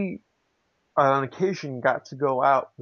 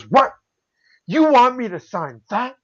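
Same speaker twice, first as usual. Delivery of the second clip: high, loud mock disbelief; imitated incredulous reply; 10 semitones higher, 7 dB louder than usual. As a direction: play him taken aback by the request. Acts out legend's incredulous reply.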